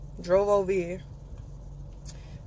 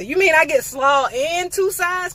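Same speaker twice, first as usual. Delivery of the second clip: delighted disbelief; 7 semitones higher, 5 dB louder than usual. Real, not acted. Disbelief at the deal.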